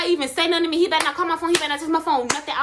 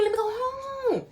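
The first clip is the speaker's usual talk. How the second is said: pleading cry; 10 semitones higher, 6 dB quieter, slower than usual. Retold or imitated. Pleading with police to go home.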